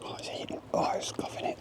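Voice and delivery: hushed whisper so owl stays; breathy whisper, barely voiced. Real, not acted. Quiet near owl.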